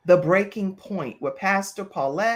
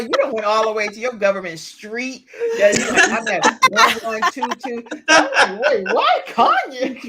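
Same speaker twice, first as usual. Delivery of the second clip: loud, higher-pitched comic disbelief; mock incredulity; 4 semitones higher, 6 dB louder than usual. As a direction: laugh through a mock-baffled reaction. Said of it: "'Cause I was like".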